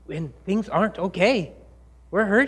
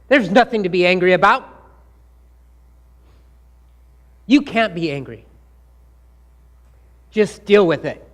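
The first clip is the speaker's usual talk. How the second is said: scolding voice; 2 semitones higher, 5 dB louder than usual. Retold or imitated. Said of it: "somebody has said something like this to you about anger".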